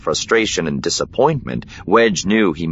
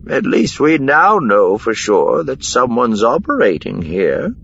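Miranda offering consolation; 6 semitones higher than usual. A narrator's reading. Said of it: "Miranda offered".

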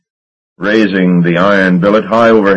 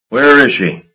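sharp, higher angry question; demanding; 6 semitones higher than usual. A narrator's reading. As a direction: demand it of the startled agent. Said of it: "Marsh demanded".

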